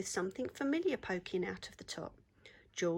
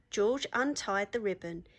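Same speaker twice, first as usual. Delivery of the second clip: plain reading voice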